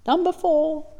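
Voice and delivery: high, light call from another room; mimicking a child calling back the answer. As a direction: call it out like a kid from the next room. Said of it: "they'll yell out".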